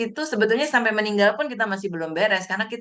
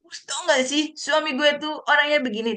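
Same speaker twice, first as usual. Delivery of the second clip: acted-out gossipy complaint, pitched much higher; gosip mengeluh; 8 semitones higher than usual. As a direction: mimic a wife griping to a friend, voice lifted high. Acting out complaining about husband. (keluhan bergosip dengan nada tinggi)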